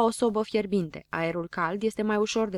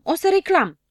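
high, loud threat to complain; indignant yell; 9 semitones higher, 8 dB louder than usual. A narrator's reading. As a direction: shout the protest. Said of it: "striga habar n-am".

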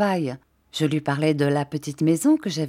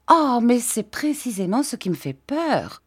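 higher-pitched fearful protest; mild childlike cry of alarm; exclaim, a touch frightened; 7 semitones higher than usual. A narrator's reading. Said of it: "cria Dora".

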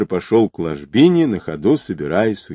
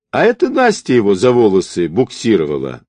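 usual speaking voice

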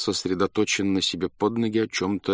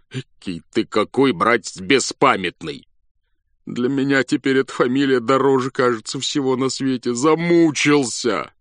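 exasperated complaint; 5 semitones higher, 4 dB louder than usual. A narrator's reading. Exhausted general reproaches clerk.

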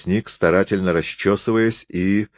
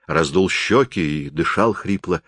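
plain reading voice

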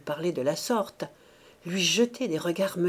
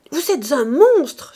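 loud, high outraged exclamation; indignant cry; 7 semitones higher, 10 dB louder, slower than usual. A narrator's reading. Rose shaking her fist at Mouret, indignant cry. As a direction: cry out the accusation, drawn out and loud. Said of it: "s'écria Rose en allant montrer le poing".

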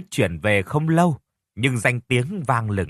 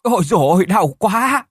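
shrill cry of pain, much higher and louder; screaming in pain; 8 semitones higher, 6 dB louder than usual. Acted. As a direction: scream out in agony. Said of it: "Tiếng kêu thất thanh". (tiếng kêu thất thanh vì đau)

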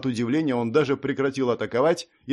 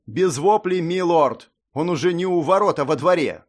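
loud, urgent soldier's report; 5 semitones higher, 5 dB louder than usual. Acted. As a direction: raise your voice to report the danger. Adjutant reports intruder in courtyard.

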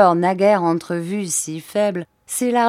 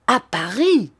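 higher startled cry; astonished exclamation; 5 semitones higher, 4 dB louder than usual. A narrator's reading. Sir Hassel cries out at news of the body, astonished. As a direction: exclaim it in sudden disbelief. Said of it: "s'écria Sir Hassel en se redressant".

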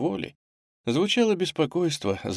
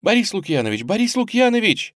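high urgent calling, rising in pitch; girl's distant cry; 11 semitones higher, 4 dB louder than usual. A narrator's reading. Girl calling from afar for help, crying out. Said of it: "издали донёсся крик девушки".

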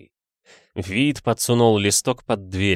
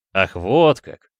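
slow, drawn-out, louder pleased exclamation; a satisfied, drawn-out aha; pleased drawl; 4 dB louder, slower than usual. Acted. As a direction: stretch it out with delight. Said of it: "обрадованно протянул Вит".

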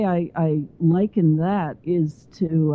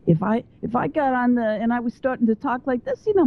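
higher, put-on Bronx accent; 5 semitones higher than usual. Shown as a demonstration. Guest demoing a Bronx accent to the host, raised pitch. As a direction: slide into a Bronx accent to make the point. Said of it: "that's a little bit from the Bronx".

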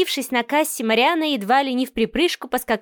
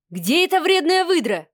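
higher-pitched angry demand, not shouted; 5 semitones higher than usual. A narrator's reading. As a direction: demand it angrily with raised pitch. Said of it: "Гневный голос Адмиралова заставил". (гневно, повышенным тоном, без крика)

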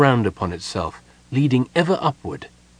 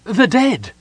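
high, loud cry of horror; distressed cry; 12 semitones higher, 5 dB louder than usual. A narrator's reading. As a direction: cry out in alarm. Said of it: "Goldmoon cried".

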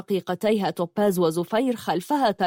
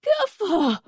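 much higher sharp cry; shouting; 11 semitones higher, much slower than usual. Acted. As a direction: cut them off with a shout. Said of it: "صاحت ميسان بانفعال".

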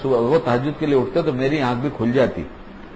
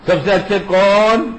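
slow, raised call-and-response question; slow teaching question; 4 semitones higher, slower than usual. Acted. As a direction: draw the children's answer out. Catechism call to children.

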